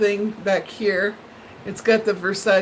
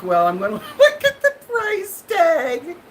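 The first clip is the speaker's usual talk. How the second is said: laughing thought; 7 semitones higher than usual. Retold or imitated. Retells checking the price.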